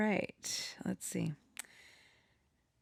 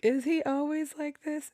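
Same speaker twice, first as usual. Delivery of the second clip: put-on much higher, teasing girl's voice; mock Eurydice; 10 semitones higher, 3 dB louder than usual. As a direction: toss it off amused and doubtful. Voicing Eurydice's teasing question.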